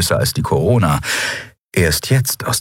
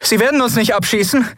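frightened question. Acted. Frightened question during attack.

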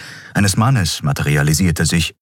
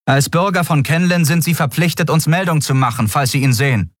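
higher, dutiful officer voice; official instruction; 10 semitones higher than usual. A narrator's reading. Guard telling Xea to report the man, higher formal voice. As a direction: recite the duty formally.

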